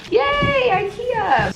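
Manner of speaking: high, bright little cheer; celebrating the IKEA haul; excited cheer. Real, not acted. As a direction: squeal it happily.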